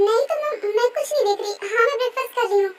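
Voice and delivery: quick, flustered denial; nervous hurried stammer. Acted. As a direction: caught out, rush to cover up. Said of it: "इतना ज्यादा घबरा गई है".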